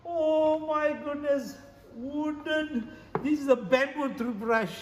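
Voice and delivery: high, loud and drawn out; slowly exclaim, pitched well up; amazed exclamation. Acted. Showing viewers another wooden find, amazed.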